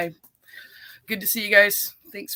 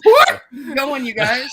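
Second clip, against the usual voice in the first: laughing as she talks; words pushed out through a laugh; 7 semitones higher, 10 dB louder, slower than usual. Real, not acted. Laughing banter among hosts.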